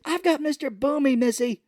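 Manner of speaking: high, hammed-up gunman voice; manic mock threat. Retells gunman threatening woman.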